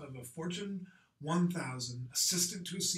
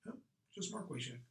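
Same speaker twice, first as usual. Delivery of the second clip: soft, offhand non-answer; coy brush-off, a little higher and quieter; curt; 4 semitones higher, 6 dB quieter than usual. Shown as a demonstration. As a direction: give the name as if it explains everything. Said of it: "the curt nature of my responses".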